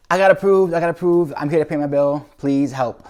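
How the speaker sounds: high, loud, breathless relief; excited rush. Retold or imitated. Owner bursting into the ER to pay, excited and loud. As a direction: burst in with the good news. Rushing in with approved credit.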